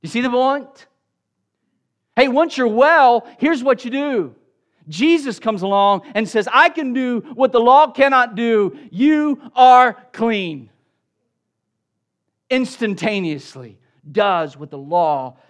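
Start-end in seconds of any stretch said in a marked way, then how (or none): 2.2-4.3 loud, raised-pitch voicing of the law; emphatic instruction. Voicing what the law says. 6.5-10.7 loud declaration, slowing to separate words; emphatic proclamation. Acted. Acting Jesus's declaration over the leper.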